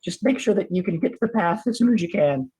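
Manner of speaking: high, rambling character voice; worked-up little watchman voice; excitable lookout. Acted. Player in character gives advice.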